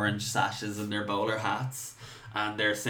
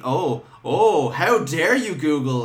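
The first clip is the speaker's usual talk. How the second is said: mock outrage; 6 semitones higher, 6 dB louder, slower than usual.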